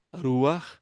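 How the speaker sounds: Ruach with a tapped R and breathy ending; Hebrew ruach. Shown as a demonstration. Teacher showing students how Hebrew ruach sounds. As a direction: model Ruach clearly. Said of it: "a palavra ruach".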